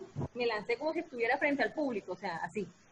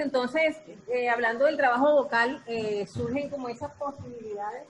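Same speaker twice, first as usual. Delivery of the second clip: ordinary talk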